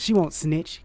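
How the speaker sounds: ordinary talk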